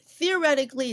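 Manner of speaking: theoretically punched high and loud; emphasis on theoretically. Shown as a demonstration. Teacher warning students about tariffs, theoretically stressed high and loud. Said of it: "I put a big emphasis on the word theoretically".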